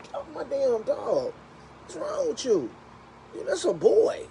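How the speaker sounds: high, slow scolding; scolding a dog. Retold or imitated. To the neighbor's dog, high indignant scolding.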